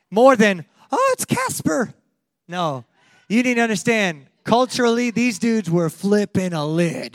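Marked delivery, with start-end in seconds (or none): 0.9-1.9 high, slow mocking voice; mock naive. Preacher mocks a naive reader: high, slow scoff. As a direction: scoff at it, pitched way up.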